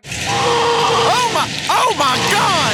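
high, strained cry of shock; overwhelmed falsetto. Acted. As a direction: shriek it out in a panic. Host overwhelmed, cries for commercial.

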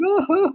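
very high hooting mock laugh; imitated mocking laugh. Mimicking his friends laughing at him in the theater, high mock laughter. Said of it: "all my friends point and laugh".

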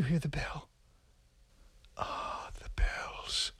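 hushed, awed whisper. Acted. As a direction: whisper as if the bell is near. Hushed listening to the bell.

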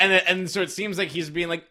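ordinary talk, nothing marked

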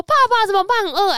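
put-on whiny hungry kid. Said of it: "大雄跟哆啦 A 梦说".

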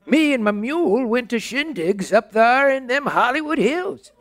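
put-on high folksy old codger; old prospector. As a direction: spin the yarn like an old miner. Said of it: "he talked in that funny way".

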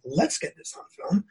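breathy disbelieving whisper on "Let's get this on film?"; incredulous echo. Retold or imitated. [name] echoing the producer in hushed disbelief. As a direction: repeat "Let's get this on film?" as if you can't believe it. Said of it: "I was like".

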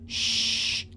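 long voiceless "shh"; urgent storybook shushing; whispered shush. A narrator's reading. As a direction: hush a noisy friend. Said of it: "Scaredy whispered".

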